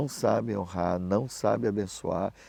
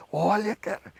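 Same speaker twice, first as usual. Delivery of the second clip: demanding; 8 semitones higher, 6 dB louder than usual. Retold or imitated. Pastor exige ver a oferta.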